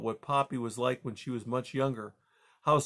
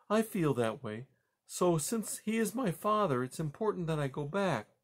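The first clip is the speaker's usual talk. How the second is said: high-pitched, tentative mother; earnest mother mouse; 7 semitones higher than usual. Acted. Mother mouse Poppy speaking.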